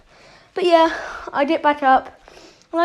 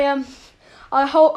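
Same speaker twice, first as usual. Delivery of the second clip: ordinary talk, nothing marked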